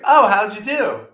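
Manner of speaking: high, loud and drawn-out imitation; mock-cheerful curiosity; oblivious eagerness. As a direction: ask it like a clueless, chirpy salesman. Acted cheerful question from company man.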